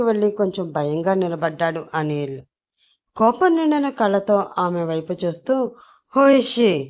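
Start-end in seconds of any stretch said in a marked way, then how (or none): 6.2-6.8 clenched, louder angry question; through gritted teeth. A narrator's reading. Raghavendra asks about the new girl through gritted teeth. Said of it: "పళ్ళు గట్టిగా కొరుకుతూ అడిగాడు".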